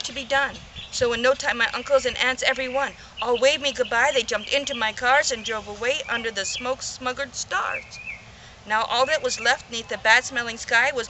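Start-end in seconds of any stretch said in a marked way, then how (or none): none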